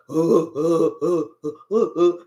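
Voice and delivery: low dopey stammering uh uh; mock stammer. Mocking the person who dodged the talk, low dumb stammer. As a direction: play them as a clueless fool stuck for words.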